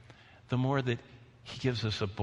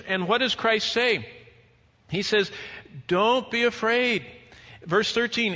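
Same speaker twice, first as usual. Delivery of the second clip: raised, emphatic pulpit voice; forceful preaching; 7 semitones higher, 5 dB louder than usual. Real, not acted. Preacher warning believers about persecution, forceful preaching. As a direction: preach it out with urgency.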